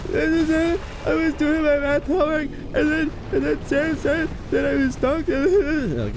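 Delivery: put-on whiny kid. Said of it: "kid snitching to teacher".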